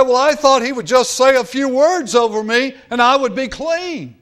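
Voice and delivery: high, loud voicing of a discouraged king; despondent complaint. Discouraged king answering his maid, in a high, complaining voice. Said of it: "He was despondent".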